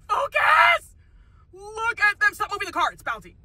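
usual speaking voice

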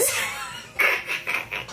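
low breathy chuckle; helpless, voiceless laugh. Real, not acted. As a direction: crack up under your breath. Cracks up at what was read.

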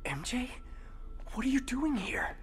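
startled, hushed question; surprised to find a friend at a break-in, whispering. Acted. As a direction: whisper in alarm. Surprised meeting during stealth.

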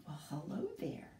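a hushed whispered hello; soft whispered greeting to the puppet. Pretend. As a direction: whisper it like a secret to the bear. Greeting the bear puppet softly.

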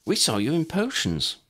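a friendly schoolgirl speaking; girl's voice. A narrator's reading. Girl's line in story.

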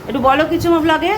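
teasingly begging the husband to talk; coaxing plea. Real, not acted. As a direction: nudge him, insistent and bright. Urging husband to speak on vlog.

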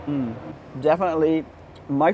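chewing while talking; muffled savoring speech; mouth full. Real, not acted. Seen 1: Bit pastry at -1.0; chewing, mouth full, through 1.9.

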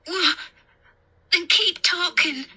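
put-on high, anxious little-boy voice; small high voice, begging; pleading child. A narrator's reading. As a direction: plead like a scared kid. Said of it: "he pleaded".

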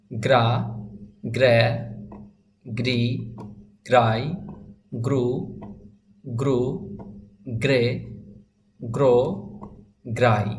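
isolated syllables with long pauses; reading without spelling. Shown as a demonstration. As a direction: drill each syllable singly, pausing after each. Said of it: "বানান ছাড়া পড়তে পারেন কিনা। এইভাবে".

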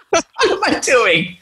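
high, slow, through helpless giggles; cracking up at your own bad acting; real laughter. Real, not acted. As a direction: laugh at yourself while trying to talk.

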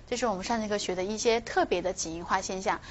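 ordinary delivery

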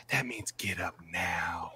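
slow, soft, whispered order; stern whisper. Retold or imitated. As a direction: hiss the order quietly and slowly. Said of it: "He's like".

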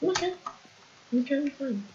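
tiny, soft high-pitched squeak; high, small voice. Acted. Player insisting they are fine after an outburst, small high voice. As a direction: reassure yourself in a very high, quiet voice.

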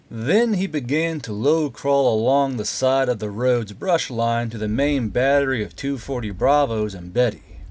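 usual speaking voice

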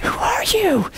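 high, breathy whispered question; awed whisper. Retold or imitated. Preacher repeating his question to Gina, awed whisper. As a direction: whisper it as if dumbstruck.

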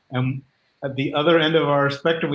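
ordinary talk, nothing marked

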